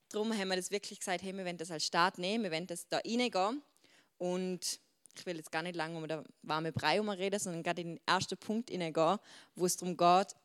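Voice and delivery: ordinary talk, nothing marked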